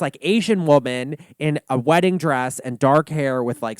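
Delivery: ordinary talk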